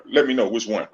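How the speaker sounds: ordinary delivery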